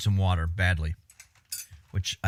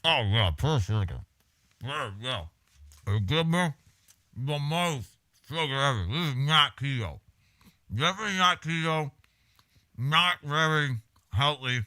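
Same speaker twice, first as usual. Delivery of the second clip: mouth full; 4 semitones higher than usual. Real, not acted. Talking while eating the pie.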